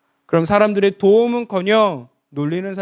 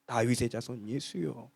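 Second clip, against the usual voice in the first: hushed cry; 8 semitones lower, 13 dB quieter than usual. Acted. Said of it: "외쳐댑니다".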